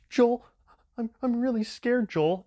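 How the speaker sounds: put-on high, loud, scared kid voice; mock-frightened kid calling for the host. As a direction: quiver like a terrified child. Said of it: "shivering and he'd be like".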